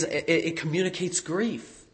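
usual speaking voice

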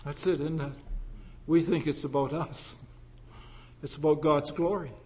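normal talking voice

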